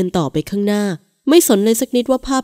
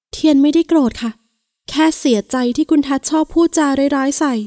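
hurt wife; 7 semitones higher than usual. A narrator's reading. Wife telling her husband his words hurt: higher, hurt. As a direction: tell him he hurt you, higher.

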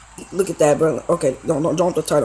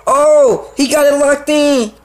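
alarmed; 6 semitones higher, 4 dB louder, slower than usual. Real, not acted. Arm lock outside the ring.

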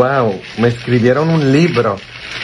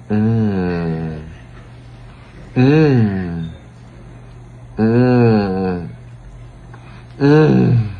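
saboreando; much slower than usual. Real, not acted. Comiendo chocolate.

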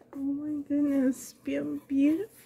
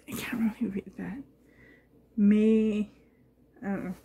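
quick whisper; 5 semitones lower, faster than usual. Real, not acted. Squinting at a gift label.

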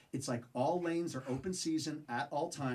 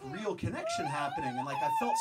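excited squeal. Real, not acted. Excited cross-cultural moment.

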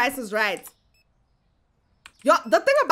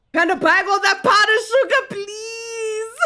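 loud, higher hammed-up wail of begging; mock crying plea; 7 semitones higher, 3 dB louder than usual. Owner pleading with a customer to buy sugar, mock wail. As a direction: wail it like a desperate shopkeeper, half laughing.

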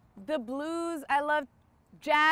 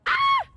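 tennis grunt; 8 dB louder than usual. Real, not acted. Hitting the ball mid rally.

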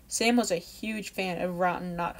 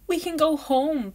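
excited; 8 semitones higher, 8 dB louder than usual. Acted. Kids glad storm ended.

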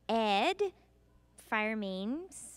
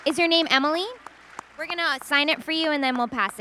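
loud, raised-pitch call across the room; 8 semitones higher, 14 dB louder than usual. Real, not acted. Panelist telling Emily they will sign her gift, calling out. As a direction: call out so Emily can hear.

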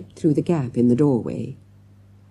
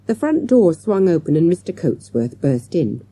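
plain reading voice

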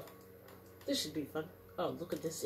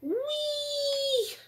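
very high, long delighted whee; gleeful squeal; 4 dB louder than usual. Real, not acted. Joyriding a captured fighter.

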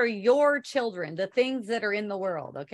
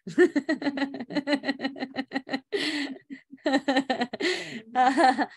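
long, breathy run of giggles; real laugh; 2 semitones higher than usual. Real, not acted. Speaker tickled by her own jazzed-up tone, laughing. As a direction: laugh on and on, helplessly.

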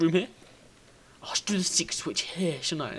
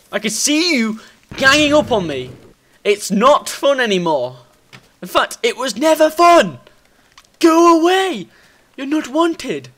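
high, loud protest at the attackers; exasperated yelling; 9 semitones higher, 10 dB louder than usual. Real, not acted. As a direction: shout them away, voice shooting up. Fed up with slimes attacking him.

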